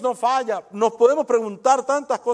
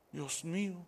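hushed mock dismay; 4 semitones lower, 16 dB quieter, slower than usual. Mock shocked congregant.

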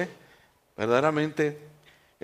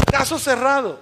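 high-pitched, mimicked verdict; playful imitation of a televised verdict; TV show catchphrase; 7 semitones higher than usual. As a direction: announce it like a TV show's closing ruling. Mimics the show's catchphrase, audience laughing.

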